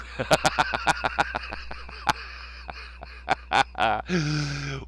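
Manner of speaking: long cackle thinning to wheezes; wheezing laugh. Real, not acted. Host cracking up at YouTube quote, wheezing laugh. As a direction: laugh yourself out of breath.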